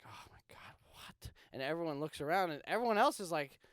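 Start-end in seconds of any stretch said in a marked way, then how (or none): 0.0-1.3 stunned under-the-breath whisper. Retold or imitated. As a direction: whisper it in shock. Stunned reaction to crying teacher.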